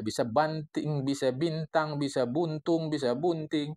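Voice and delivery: banting, bintang, buntung, bunting each said distinctly; slow list of misreadings; reading variants. Shown as a demonstration. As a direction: spell out the possible readings one by one. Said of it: "Kalau apalagi nggak ada ia".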